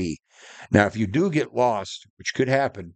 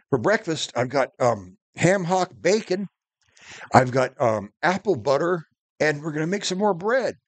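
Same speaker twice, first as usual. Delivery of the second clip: higher, mock-cheerful menu listing; mock chirpy outdoorsman; 6 semitones higher than usual. Retelling the video guy's gourmet breakfast, higher and mock-chirpy. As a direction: ham it up like a proud camp chef. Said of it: "he goes".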